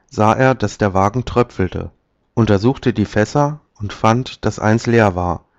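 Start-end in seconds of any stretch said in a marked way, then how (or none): none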